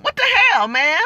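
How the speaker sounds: sudden high cry of exasperation; exasperated outburst. Real, not acted. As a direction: blurt it out in disbelief. Outraged rant.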